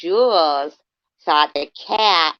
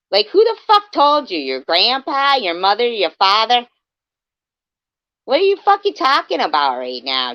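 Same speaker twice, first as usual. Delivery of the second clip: loud, higher, incredulous yelling; ranting; 6 semitones higher, 5 dB louder than usual. Real, not acted. Streamer yells at Jules over her story, loud. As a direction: blast the question at her, exasperated.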